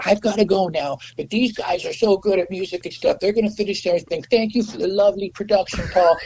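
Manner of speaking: high-pitched put-on farewell voice; imitating the drummer. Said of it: "he goes".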